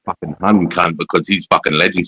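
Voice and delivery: quoted through laughter; laughing mock quote. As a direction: laugh while playing the guy. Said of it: "And then you go".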